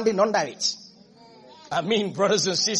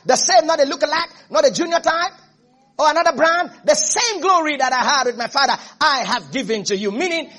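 higher, louder building sermon; fervent preaching; 5 semitones higher, 3 dB louder than usual. Acted. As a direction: rise up and proclaim it. Preaching at full force.

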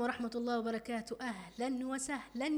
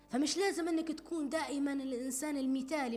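normal talking voice

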